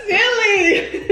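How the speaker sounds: high giggling laugh. Real, not acted. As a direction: dissolve into giggles. Mother laughs at child.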